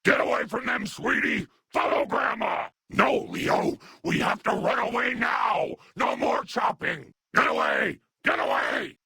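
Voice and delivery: high, urgent cries to flee; high-pitched alarmed yelling; panicked retreat. Acted. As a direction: shout a frantic warning to run. Urgent retreat shouted to companion.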